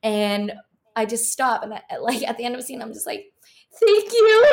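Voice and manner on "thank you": high, loud, choked with tears; tearful thank you. Retold or imitated. Finishing the audition mortified, crying out a thank you. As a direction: push the words out through crying. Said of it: "I'm, like, real crying at that point".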